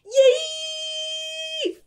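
high, loud cheering shout; excited cheer. Real, not acted. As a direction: cheer at the screen. Cheering during movie.

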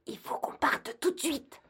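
high urgent whisper; panicked hushed whisper. Acted. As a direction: whisper so the hunters don't hear. Hiding from hunters.

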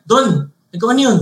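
imitating a cheated, angry classmate. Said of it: "galit na galit yung kaklase ko".

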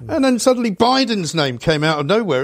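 ordinary talk

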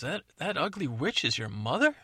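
loud shocked outburst; shocked prince. Acted. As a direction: gasp it out in disbelief.

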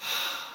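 long unvoiced breath, in and out; big exaggerated breath; deep breath demo. Shown as a demonstration. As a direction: show the deepest breath possible. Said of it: "I go".

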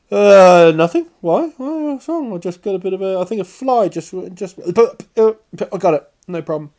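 high, flustered fake innocence; mock denial. Pretending to a friend that nothing is wrong, high and flustered. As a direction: squeak out an unconvincing nothing. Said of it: "You're like".

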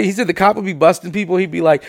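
ordinary talk, nothing marked

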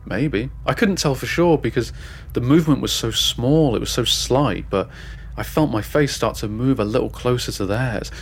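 ordinary delivery, nothing marked

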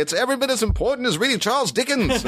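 very high-pitched, hammed-up English accent; stupid English accent. Dad arguing with the school over a late note, mock English accent. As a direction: put on a silly, high English voice. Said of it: "doing a stupid sort of English accent".

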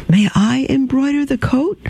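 put-on high, earnest little girl pleading. Orphan Mimosa asking the nun if the princess can play, high childlike. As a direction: lift into a small child's eager plea.

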